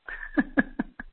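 short chuckle; a brief, soft laugh to herself; light laugh. Real, not acted. Speaker amused by her friend's reply, light chuckle.

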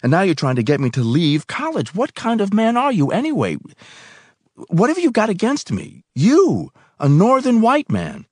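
heated accusation building to a high, loud pitch; escalating angry outburst. A narrator's reading. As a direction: confront them, voice climbing with indignation. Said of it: "I said angrily".

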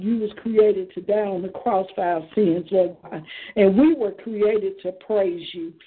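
usual speaking voice

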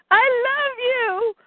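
high, slow blubbering confession; tearful love declaration, played for laughs; mock sobbing. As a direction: wail it out like a hammed-up weeper. [name] grabs Barta, declares love.

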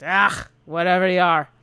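lightly voiced gruff dwarf, irritated; dismissive gruff dwarf. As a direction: brush off the correction in dwarf voice.

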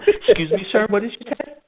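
can't believe what he heard; shocked. Retold or imitated. Man told he has warrants, high shocked.

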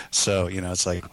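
normal talking voice

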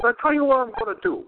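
quick, lightly voiced owner making a deal; imitating Jimmy. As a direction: rattle off the offer fast. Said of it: "he goes".

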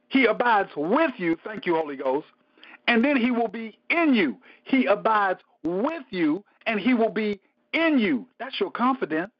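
ordinary delivery

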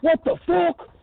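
high-pitched mock indignation; mock outrage. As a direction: squawk it like someone offended, leaping up in pitch. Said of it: "You're all mad. You're like".

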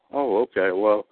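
usual speaking voice

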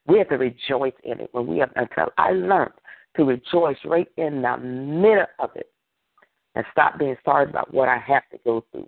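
usual speaking voice

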